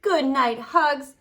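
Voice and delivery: ordinary delivery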